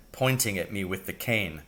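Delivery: ordinary delivery, nothing marked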